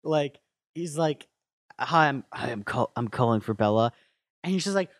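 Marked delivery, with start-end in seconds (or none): 1.8-3.9 lower caller voice. Retold or imitated. Edward caller voice.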